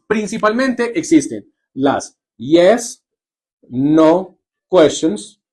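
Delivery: drawn-out teacher's slow naming of "yes, no questions"; slow, careful. Shown as a demonstration. Teacher introducing the term to her class, said slowly. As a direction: stretch out "las yes, no questions" slowly, word by word. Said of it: "¿Listo?".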